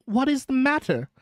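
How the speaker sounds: high-pitched, slightly nervous passenger; mildly quavering. A narrator's reading. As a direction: ask with a touch of alarm. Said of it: "with mildly quavering speech".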